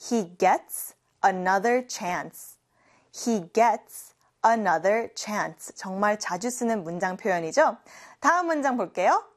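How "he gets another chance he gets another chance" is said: He gets another chance, very slowly, word by word; slow it right down so they can follow; slow repeat-after-me. Shown as a demonstration. Teacher leads class repetition, very slow and clear. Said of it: "같이 해볼까요?".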